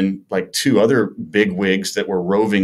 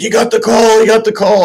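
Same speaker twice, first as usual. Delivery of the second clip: high, loud imitation of a crowd celebrating; cheering yell; 7 dB louder than usual. Tavern crowd hearing of the call-up, retold cheering yell. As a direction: whoop it out like a full bar cheering. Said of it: "everybody's cheering and screaming".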